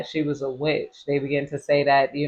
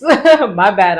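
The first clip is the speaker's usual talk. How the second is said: laughing; 5 semitones higher, 10 dB louder than usual. Real, not acted. Laughing at own slip.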